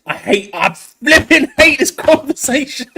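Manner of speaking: really worked up, let it boil over. Real, not acted. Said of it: "I'm getting really worked up".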